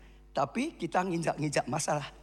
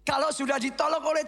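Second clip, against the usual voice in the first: preaching at full force; 9 semitones higher than usual. Acted. Pastor shouts encouragement to the congregation, full fervour. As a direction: preach it out with fire.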